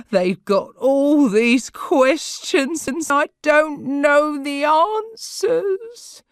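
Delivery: high, loud sobbing words, slow; crying. A narrator's reading. Sarah cries to Corrie about being watched. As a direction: cry it out in panic. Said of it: "burst into tears".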